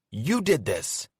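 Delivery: loud high furious accusation; angry accusation. Acted. Evie turning on Carter, angry accusation. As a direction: hurl the blame at him.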